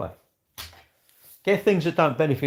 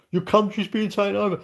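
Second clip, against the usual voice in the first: mock worried Brit; 8 semitones higher than usual. Mocking people who fear a takeover.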